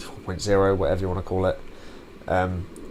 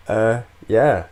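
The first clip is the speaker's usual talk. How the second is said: ordinary talk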